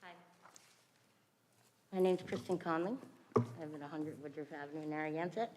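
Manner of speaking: quiet, muffled behind a face mask. Real, not acted. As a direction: speak through a mask, away from the mic. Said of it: "Could you speak into the microphone".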